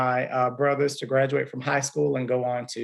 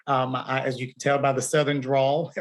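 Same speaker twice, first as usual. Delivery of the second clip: ordinary talk, nothing marked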